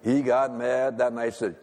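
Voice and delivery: usual speaking voice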